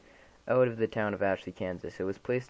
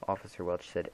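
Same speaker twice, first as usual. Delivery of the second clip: ordinary delivery, nothing marked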